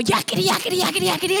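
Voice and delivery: lightly voiced animal jabber; squeaky creature. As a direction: imitate a chattering critter. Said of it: "And the animal".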